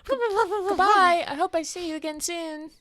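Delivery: high sweet goodbye voice; cute character farewell. Acted. Player character calls sweetly after the fleeing bird. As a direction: wave off the bird in a high sing-song voice.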